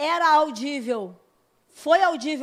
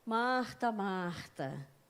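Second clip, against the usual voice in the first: lower, softer name calling; tender calling; 6 semitones lower, 9 dB quieter, much slower than usual. Retold or imitated. Jesus tenderly calling Martha by name. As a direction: say the name gently, letting it fall. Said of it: "Ele fala".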